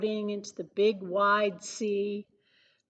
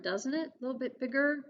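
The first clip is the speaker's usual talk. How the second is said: plain reading voice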